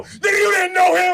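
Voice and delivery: high, loud mocking yell; mock shout. Boy calling out the fan, high loud mock shout.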